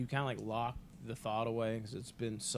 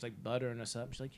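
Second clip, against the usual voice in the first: ordinary delivery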